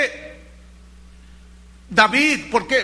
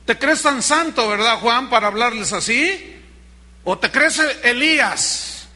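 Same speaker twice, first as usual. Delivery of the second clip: exasperated telling-off, raised voice; mock scolding; 4 semitones higher, 4 dB louder than usual. Jesus imagined telling Juan off for scaring people, mock scolding. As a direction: scold him louder and higher, hammed-up. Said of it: "¿Así le dijo?".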